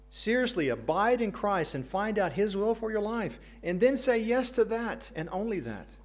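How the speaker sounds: rising to a fervent call; fiery preaching. Acted. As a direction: preach it high and loud. Preaching at full force.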